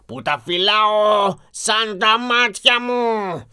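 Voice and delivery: slow, menacing beast voice rising to a loud threat; frightening monster. Acted. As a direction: draw it out like a beast passing sentence. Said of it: "είπε το τέρας με φωνή τρομακτική".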